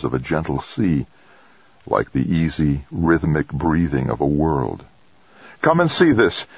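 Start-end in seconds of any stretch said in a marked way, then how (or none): 5.6-6.5 high-pitched call to the others; raised, high summoning voice. A narrator's reading. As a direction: call them over to look. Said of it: "he called".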